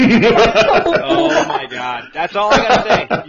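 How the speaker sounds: high, loud words through laughter; helpless laughing exclamation, drawn out; cracking up. Real, not acted. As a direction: barely get it out for laughing. Cracks up at film's absurd plot.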